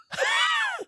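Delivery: very high-pitched laugh; burst of laughter. Real, not acted. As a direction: burst out laughing at your own joke. Laughing at own falling-asleep joke.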